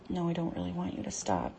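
usual speaking voice

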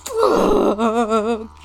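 long loud crying groan. Real, not acted. Drawn-out release.

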